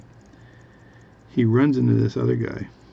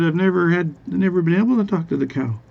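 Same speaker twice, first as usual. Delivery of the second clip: higher-pitched acted wonder; amazed farmer; 7 semitones higher, 5 dB louder, faster than usual. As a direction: marvel in a raised joke-teller's voice. Farmer amazed at talking cow.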